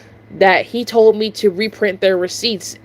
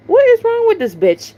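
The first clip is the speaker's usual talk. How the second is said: much higher, exasperated disbelief; incredulous outrage; 11 semitones higher than usual. Retold or imitated. Cashier baffled by a staring customer, high incredulous outrage. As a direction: throw up your hands at her.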